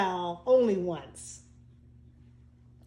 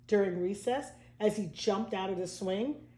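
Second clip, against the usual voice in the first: ordinary delivery, nothing marked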